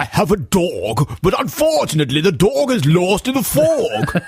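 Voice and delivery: hammed-up British rounded O's; mock posh British. Fake Londoner uses coach's sentences, mock posh British. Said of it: "started talking like that".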